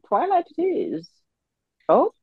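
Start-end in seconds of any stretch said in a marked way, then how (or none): 0.1-1.1 character voice. Acted. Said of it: "Is that my character voice?".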